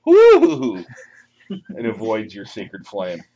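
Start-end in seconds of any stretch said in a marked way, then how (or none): 0.1-0.8 loud, high-pitched whoop; big high "woo" for a narrow escape; dodging whoop. Acted. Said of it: "He goes".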